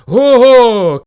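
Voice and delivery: loud, high hollered ho-ho; rival bear's shout. A narrator's reading. Rival bear scoffs at the hares, shouting. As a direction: bellow scornfully. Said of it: "крикнув він".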